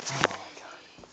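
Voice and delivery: exasperated whisper. Real, not acted. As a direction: breathe it out in disbelief. Exasperated at the chaos.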